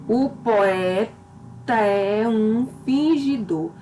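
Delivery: "o poeta é um fingidor" said slowly, linking "poeta é um" into joined sounds; slow, scanned reading of the verse; demonstrating metric syllables; poetic syllables. Shown as a demonstration. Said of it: "onde eu vou unir sons do mesmo verso".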